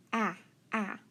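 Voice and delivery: "a" as in apple, twice; brief "a" sounds, not the letter name; short vowel sound. Shown as a demonstration. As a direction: pop out a short, separate "a", like "aah" cut short. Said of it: "we're gonna try our spelling sounds".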